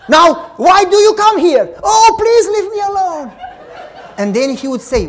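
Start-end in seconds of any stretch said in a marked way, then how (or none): 0.1-3.3 high, loud mock cries of pain; fake screaming. Child told to scream too, faking a loud plea. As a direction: ham up a pretend spanking. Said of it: "You got to scream too".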